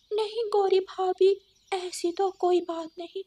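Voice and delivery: high, meek denial; timid crow sister. Acted. Kali denies to harsh sister-in-law, high and meek. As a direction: protest meekly, pitched high.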